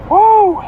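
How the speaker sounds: a sudden high, loud woo; loud whoop. Real, not acted. Driver whooping to shake off tiredness, loud whoop. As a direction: let out a big whoop to wake yourself up. Burst of energy while driving.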